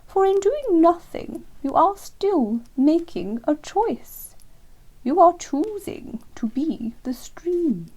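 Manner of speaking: slow, high, lofty lecturing owl voice; superior wise owl. Acted. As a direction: explain like a grand old teacher, slowly.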